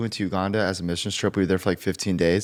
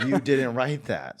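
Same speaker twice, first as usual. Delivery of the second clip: laughing disbelief; 4 semitones higher, slower than usual. Retold or imitated. Disbelief at the singer.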